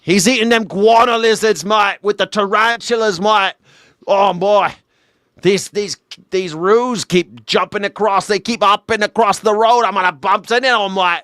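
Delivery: high-pitched, excitable Aussie parody; exaggerated Australian accent. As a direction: yelp it like a cartoon Australian. Aussie driver parody over lizards, roos.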